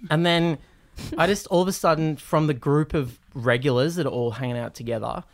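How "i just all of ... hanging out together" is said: ordinary talk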